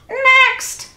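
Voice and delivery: very high squawked "Next"; high parrot screech, ordering. A narrator's reading. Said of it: "squawked the bird".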